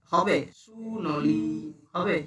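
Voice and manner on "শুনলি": "শুনলি" pulled apart with long vowels; overdone pronunciation. Retold or imitated. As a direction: imitate overworked, stretched-out "শুনলি". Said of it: "কেরিকাচা করতে শুরু করেছো". ("শুনলি" কায়দা করে টেনে উচ্চারণ)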